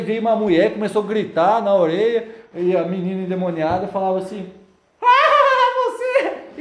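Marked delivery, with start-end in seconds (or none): 5.0-6.6 very high, loud mocking cry; shrill demon taunt. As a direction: shriek like the possessed girl. (grito agudo de demônio)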